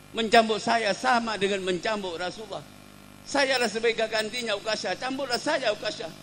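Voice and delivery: high, urgent plea; pleading. Acted. Hasan and Husin offer themselves to Ukasyah, pleading. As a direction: beg to take the blows instead. Grandsons plead to take the whipping.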